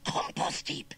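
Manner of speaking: husky, unvoiced-edged dread; muttered. Uncle dreading the threat while shovelling, husky mutter. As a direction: rasp it out anxiously. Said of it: "he muttered, shoveling even more frantically".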